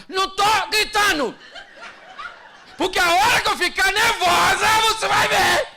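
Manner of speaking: wife yelling at husband that she isn't yelling; shouting. Acted. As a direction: scream that you are not screaming. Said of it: "Ela sabe que tá gritando".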